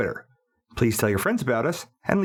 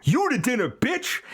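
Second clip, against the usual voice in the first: Jaws talking; 9 semitones higher than usual. Acted. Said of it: "Jaws jumps off the plate and says".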